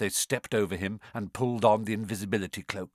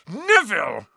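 exploding; 8 semitones higher, 7 dB louder than usual. A narrator's reading. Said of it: "Ron exploded".

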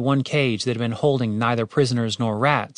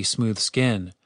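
plain reading voice

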